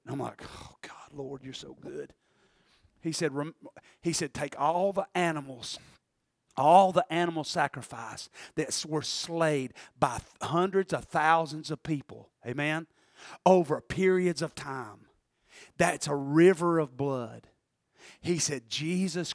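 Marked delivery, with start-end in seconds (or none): 0.5-2.1 very soft, low prayer; hushed, reverent aside; awed murmur. Retold or imitated. Retelling his awed response to radio sermon. 4.3-17.5 low, soft, measured sermon; hushed preacher building a picture; radio preacher. Retold or imitated. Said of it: "this man's preaching out of Leviticus".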